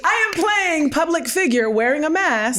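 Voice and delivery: high-pitched mock brave front; forced composure. Woman mocking her masked public self, forced composure. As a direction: play up the strained niceness, very high. Acting out suppressing incredibly well.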